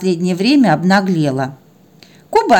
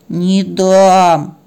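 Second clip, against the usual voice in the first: drawn-out, sullen refusal; stubborn dog; 4 dB louder than usual. Acted. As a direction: dig in and stretch it out. (упрямо тянет слова)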